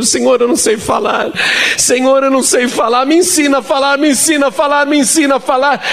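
re-enacted desperate prayer, very high-pitched; fearful pleading. Frightened young preacher begging the Lord to teach him, desperate pleading. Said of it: "E eu tinha tanto medo".

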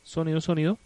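loud sound check, rising at the end; prueba de sonido. Real, not acted. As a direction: call out a mic test. Probando el sonido de la emisora. (probando el sonido en voz alta)

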